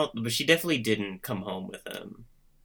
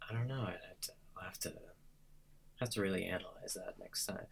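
quiet; 15 dB quieter, faster than usual. Real, not acted. Trailing off quietly.

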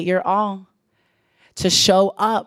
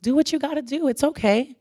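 higher, softer, gently reassuring; a light imitation of soothing family; tender reassurance; 6 semitones higher, 3 dB quieter than usual. Family telling the exhausted speaker to rest, softly and higher. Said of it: "my family saying".

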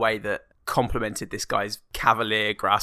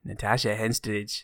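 put-on voice; 5 dB quieter, slower than usual. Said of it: "That's pretty good".

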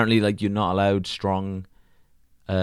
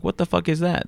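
incredulous; 10 semitones higher than usual. Retold or imitated. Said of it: "as if to be like".

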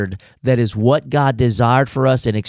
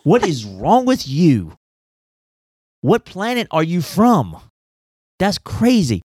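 mock scolding, slowed and high; 5 semitones higher, slower than usual. Real, not acted. Preacher scolding spider lovers.

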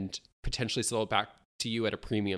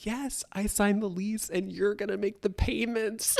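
gushing mother; 9 semitones higher than usual. Retold or imitated. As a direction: deliver the catch sweetly. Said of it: "Vicky goes".

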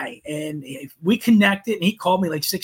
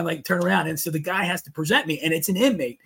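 usual speaking voice

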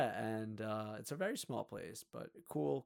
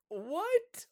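shocked what; 5 dB louder than usual. Retold or imitated. Shocked reaction in story.

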